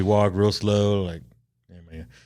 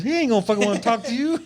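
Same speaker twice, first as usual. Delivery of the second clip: mock retort; 14 semitones higher, faster than usual. Retelling his own retort.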